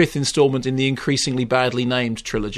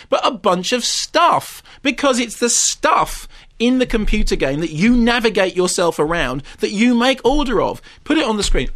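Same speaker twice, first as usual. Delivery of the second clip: higher-pitched, indignant, emphatic retelling; exasperated rant; 5 semitones higher than usual. Real, not acted. Critic telling presenter how the plot fails, exasperated rant. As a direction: spell out the failings with mounting disbelief.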